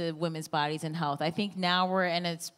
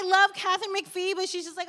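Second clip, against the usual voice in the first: ordinary delivery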